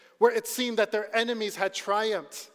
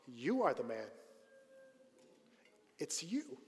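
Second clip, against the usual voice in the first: solemn accusation; 2 semitones lower, 12 dB quieter than usual. Retold or imitated. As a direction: say it softly, pointing at him. Prophet confronts the guilty king.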